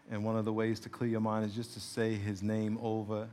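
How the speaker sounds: hushed, low, even prayer leading; guided prayer. Real, not acted. Pastor guiding congregation to wait on God, hushed. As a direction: guide them softly into stillness. Leads silent waiting prayer.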